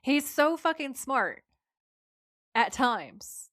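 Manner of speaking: slow, high "He's so fucking smart", long pause, "At times"; pause before qualifier. Real, not acted. Host defending Dean, long comic pause before "At times". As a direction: gush loudly, stop, then concede "At times". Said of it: "I like that [name] said".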